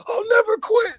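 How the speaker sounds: high, loud hammed-up yell; mock defiant wrestler. [name] answering the taunt, in a high hammed-up yell. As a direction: scream it like a stubborn wrestler. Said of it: "he's just like".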